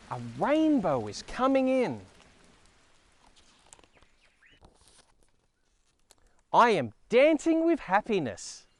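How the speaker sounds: put-on slow, sing-song reading for small children; picture book reading. Reading Thank You Rain aloud to young viewers, gentle storytelling. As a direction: read it out like story time, savouring each word.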